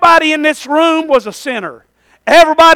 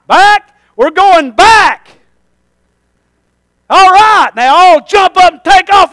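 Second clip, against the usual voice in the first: excited shout; 4 semitones higher, 4 dB louder than usual. Acted. Preacher shouting the lepers' joy.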